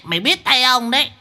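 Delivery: high grumbling demon guard; a lazy demon cursing its ruined holiday; high gruff demon threat. Acted. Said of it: "văng vẳng vọng lên".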